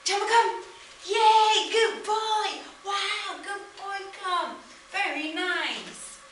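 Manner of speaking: high, loud cheering at the dog; enthusiastic recall. Shown as a demonstration. Trainer showing owners how to call the dog, high and loud. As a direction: gush with praise like a cheerleader. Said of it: "So let me show you".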